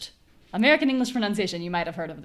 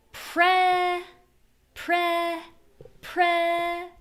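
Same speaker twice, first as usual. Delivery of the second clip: "pre" held high and long on a clear eh; strong stressed syllable; 10 semitones higher, much slower than usual. Shown as a demonstration. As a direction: sustain "pre" on a high level note. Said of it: "and higher voice".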